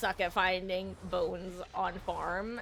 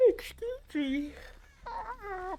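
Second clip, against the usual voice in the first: talking through a big yawn; words stretched by a yawn; 12 semitones higher, 3 dB quieter, slower than usual. Real, not acted. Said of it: "big yawn".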